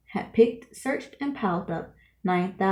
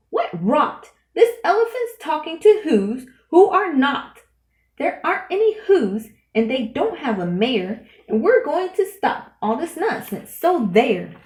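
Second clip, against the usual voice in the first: louder, higher jeering shout; 6 semitones higher, 4 dB louder than usual. A narrator's reading. The Wickersham monkeys jeering at Horton, loud and higher. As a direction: shout them down like bullying monkeys. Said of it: "came shouting".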